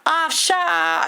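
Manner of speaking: very high, loud, slow mock girl's scoff. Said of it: "the girls are like".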